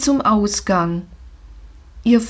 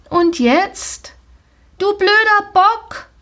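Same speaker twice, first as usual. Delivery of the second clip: shouting; 10 semitones higher than usual. Acted. Rider Jolina yells at her stubborn pony, shouting. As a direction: yell down at the horse. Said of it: "schrie Jolina".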